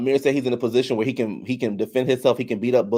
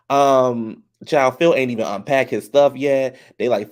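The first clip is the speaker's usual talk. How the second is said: normal talking voice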